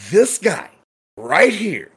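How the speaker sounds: high, punched accusation; retold pointing out. Bartender pointing out the owner to the GM, incredulous. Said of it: "So I was like".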